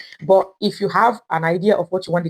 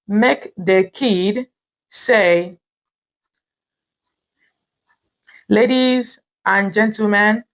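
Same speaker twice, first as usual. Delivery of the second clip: word by word with long pauses; slow dictation; much slower than usual. Real, not acted. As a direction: dictate it slowly as you type, repeating bits. Dictating aloud while typing the prompt.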